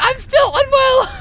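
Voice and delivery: high, loud and slow wail; hammed-up injured wail; mock anguished cry. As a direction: drag out the protest, loud and high. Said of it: "I was like".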